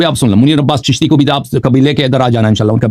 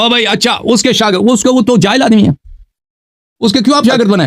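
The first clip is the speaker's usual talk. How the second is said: heated; 6 semitones higher than usual. Real, not acted. Heated argument over the teacher.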